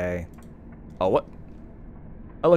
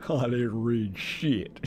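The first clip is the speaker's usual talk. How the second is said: slow mock southern drawl; lightly hammed southern accent; 3 dB quieter, slower than usual. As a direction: drag it out in a put-on southern accent. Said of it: "over the southern accent".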